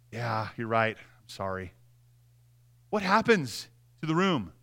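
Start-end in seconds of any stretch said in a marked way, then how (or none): 0.1-1.7 soft, low, humble giving in; meek apology. Acted. Acting out the reply to an accuser, soft and meek. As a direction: back down gently with a quiet sorry.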